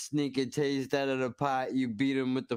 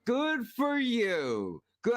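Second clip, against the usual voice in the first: sarcastic; 9 semitones higher, much slower than usual. Acted. Sarcastic reply to cook.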